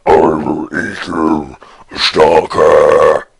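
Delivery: very low, slow death metal growl; Butcher from Diablo. Retold or imitated. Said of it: "him talking like the Butcher from Diablo one".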